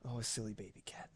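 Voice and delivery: fast soft whisper to the cat. Real, not acted. Streamer whispering fondly to her cat.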